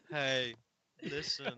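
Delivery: low-key fairy in his own low register; deadpan fairy. Acted. Host correcting co-host on how he'd play the fairy, deadpan. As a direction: undersell the fairy's call, no squeal. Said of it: "it'd be more like".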